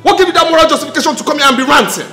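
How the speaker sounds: high, loud, fast rant; indignant, spluttering outburst; angry shouting. Acted. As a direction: rant at the intruder at high pitch. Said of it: "You can now shout".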